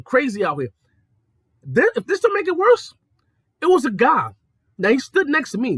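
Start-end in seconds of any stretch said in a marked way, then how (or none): 1.6-4.3 high, loud storytelling build-up; pitched-up dramatic setup; dramatic emphasis. Real, not acted. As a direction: raise it up for the reveal.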